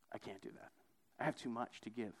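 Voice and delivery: acting out a hushed refusal; quiet refusal. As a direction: back away quietly, voice dropped low. Rich man's reluctant refusal.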